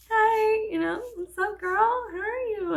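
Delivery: high, loud fangirl hello; acted-out giddy girly greeting; gushing greeting. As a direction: gush at the friend you adore. Acting own eager greeting to friend.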